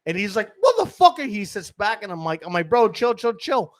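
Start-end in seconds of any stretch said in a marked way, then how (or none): 0.6-1.3 very high, loud retold outrage; enraged outburst. Trainee spat on in the face, enraged outburst. 2.7-3.7 high, urgent retold plea; urgent calming plea. Hustler restraining his spat-on trainee, urgent plea.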